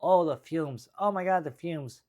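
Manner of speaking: worried Jersey woman, pitched high; Jersey accent. Acted. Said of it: "do the Jersey accent".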